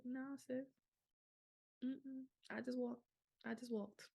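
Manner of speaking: high, small, soft voice; retold meek denial on the phone; acted sheepish reply. Acts her winded reply to cousin.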